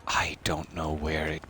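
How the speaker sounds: hushed whisper to self. Real, not acted. To chat about the lost submarine, whispered. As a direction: whisper it, baffled.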